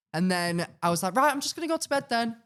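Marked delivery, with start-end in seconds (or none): 1.2-2.4 awkward high voice. Retold or imitated. Retold awkward exit.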